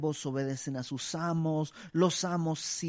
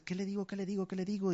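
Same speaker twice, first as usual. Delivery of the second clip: higher-pitched, hurried mock fretting; acted fretting; 5 semitones higher, much faster than usual. Believer at a loss what to tell a fallen brother, acted fretting. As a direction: repeat it urgently, as if stuck for words. Acts out searching for words.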